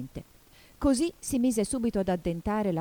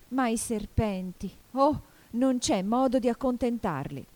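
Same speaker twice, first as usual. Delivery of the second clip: higher, louder exasperated complaint; exasperated lament; 6 semitones higher, 4 dB louder than usual. Acted. Pigeon cannot satisfy the snakes, exasperated lament.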